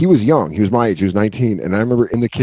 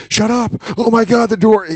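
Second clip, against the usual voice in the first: much higher panicked "shut up, oh my God"; flustered outburst; 10 semitones higher than usual. Retold or imitated. Rattled by a friend's mind games, high flustered outburst. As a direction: squeak it like you're rattled. Friend getting in your head.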